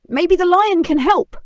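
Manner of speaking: high, bright helpful suggestion; animal friend. A narrator's reading. Animal friend suggests the lion, high and eager. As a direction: pitch the idea up high.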